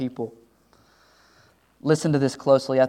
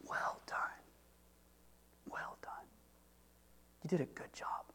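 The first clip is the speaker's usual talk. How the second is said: a hushed, intimate whisper of praise; 21 dB quieter than usual. Acted. God whispers his praise to a faithful servant, hushed whisper. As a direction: breathe the praise like a secret.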